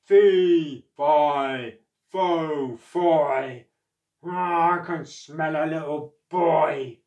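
loud booming giant chant; giant's shout. Acted. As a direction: bellow it like a giant. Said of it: "shouted the giant".

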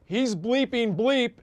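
breathless warning that the coach is coming; excited producer in ear. Retold or imitated. Producer in his earpiece warning the coach is coming, alarmed. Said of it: "in my ear saying".

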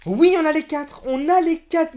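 high, loud joyful yes; thrilled. Real, not acted. Youtuber telling viewers he got all four, thrilled. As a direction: shout the win.

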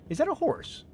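surprised. Real, not acted. Disgusted surprise at monster.

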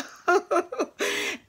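a burst of high giggling laughter; high bright laugh. Real, not acted. Trucker telling viewers about flirting truckers, high laugh. Laughing at her own story.

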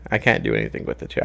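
ordinary delivery

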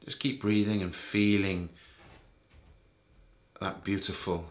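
soft, low voice with long pauses between phrases; guided meditation. Real, not acted. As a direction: ease the listener gently towards sleep. Leads a bedtime meditation.

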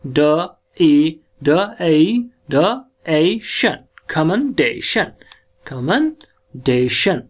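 कमेंडेशन split into slow sounds; sounding out. Shown as a demonstration. Teacher dictating syllables to class, slow. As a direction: break कमेंडेशन into pieces, slowly. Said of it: "क पे लगना है".

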